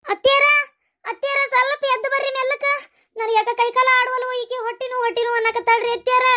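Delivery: high, urgent summons; panicked calling. Acted. Woman calling mother-in-law to the labouring girl, panicked. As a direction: call for help, losing your nerve.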